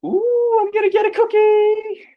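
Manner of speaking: put-on very high, loud delighted kid; excited child. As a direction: squeal like a kid promised a cookie. Kid hoping for a treat.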